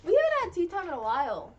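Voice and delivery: loud and high, thrilled it's tea time; high loud excited tea-time call; excited announcement. Real, not acted. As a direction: proclaim tea time with glee.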